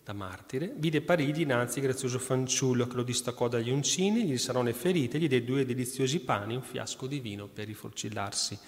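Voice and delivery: lettura solenne, ritmo regolare; lettura agiografica. Acted. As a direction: racconta la vita del santo al pubblico. Reads saint's life aloud. (lettura devota ad alta voce)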